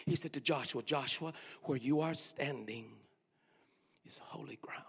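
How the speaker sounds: drops to a whisper on holy ground; whispered verse for those who missed it; whispered repeat. Shown as a demonstration. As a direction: repeat it low, ending in a whisper. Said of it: "Let me say it one more time".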